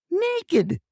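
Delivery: high-pitched yelp of Naked; shrill cry of naked. Retold or imitated. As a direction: squeal the word naked high. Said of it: "She's saying".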